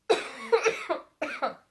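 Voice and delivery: sharp loud cough. Real, not acted. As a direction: cough hard. Cough mid sentence.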